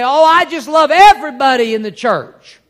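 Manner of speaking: loud, high, gushing mock church member. Preacher mocking what people say at church, loud gush. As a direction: lay on the gush like a careless churchgoer. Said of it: "you'll hear people say".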